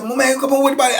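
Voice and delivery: high-pitched pleading objection; imitated protest. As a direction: whine back as the onlookers. Onlookers protest being put out.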